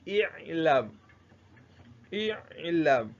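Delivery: "i'lam" opening on a short i sound; kesra pronunciation. Shown as a demonstration. Teacher models the imperative for students, kesra pronunciation. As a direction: say "i'lam" with an initial i vowel, drawn out for learners. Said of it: "going to be pronounced as Kesra".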